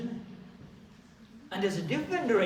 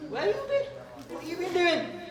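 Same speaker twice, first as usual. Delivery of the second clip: much higher scolding parent voice; nagging parents; 6 semitones higher than usual. Retold or imitated. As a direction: play the nagging mum and dad. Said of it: "Mom and dad are gonna be like".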